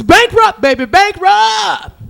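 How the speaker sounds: high, loud, drawn-out yell of bankrupt; yelled game-show cry. Acted. Contestant screaming at the wheel, loud and high. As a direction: shout it like a crazed contestant.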